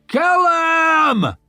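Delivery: high, drawn-out roar. Acted. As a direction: roar it over the din. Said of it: "Glaward roaring over his shield".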